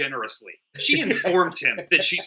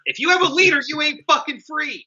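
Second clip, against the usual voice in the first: louder and much higher rant; emphatic rant; 11 semitones higher, 3 dB louder than usual. Real, not acted. Warning kids off cult leaders, loud emphatic rant. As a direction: drive the point home hard.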